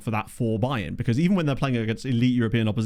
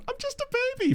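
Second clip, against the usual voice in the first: much higher mock baby wail; imitating a yell; 3 dB quieter, faster than usual. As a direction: squeak it like a toddler. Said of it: "Pogs literally just yelled".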